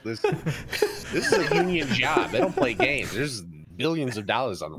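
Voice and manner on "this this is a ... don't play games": high, slow, laughing. Acted. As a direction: squeeze it out through laughter.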